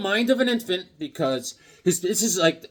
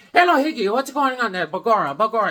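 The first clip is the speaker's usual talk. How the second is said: higher, louder mock boss voice; chatty boss; 4 semitones higher, 5 dB louder than usual. Irish boss chatting on the way to the bathroom, higher. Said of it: "he's talking to me".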